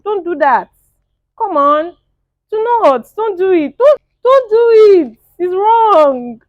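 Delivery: high, insistent, climbing plea; loud pleading. Real, not acted. Vlogger urging a woman off a disrespectful man, loud high pleading. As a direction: beg her loudly, pitch climbing with each don't.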